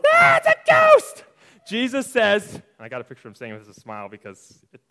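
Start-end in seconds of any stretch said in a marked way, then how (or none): 0.0-1.2 loud, drawn-out falsetto shriek; shouting like little girls. Retold or imitated. Teacher mimics disciples seeing a ghost, girlish shout. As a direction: shriek it like a scared little girl. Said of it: "are shouting like little girls".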